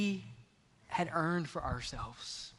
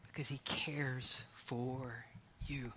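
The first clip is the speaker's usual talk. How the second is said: softer and slow, gentle; soft reassurance; 4 semitones lower, 12 dB quieter, slower than usual. Acted. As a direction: let it land tenderly. Answer to his own question.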